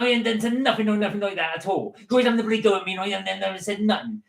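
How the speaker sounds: high, mouthy West Country denial; indignant West Country teen; Vicky Pollard impression. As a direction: protest innocence in broad West Country. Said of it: "like Vicky Pollard".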